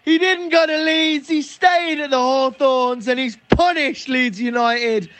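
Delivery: high, drawn-out commentator's cry; excited goal call. Real, not acted. Commentator announcing his team's goal, high excited shouting. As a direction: shout the goal out in triumph, easing slightly.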